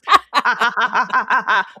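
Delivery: villainous cackle; evil laugh. Pretend. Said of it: "My evil laugh".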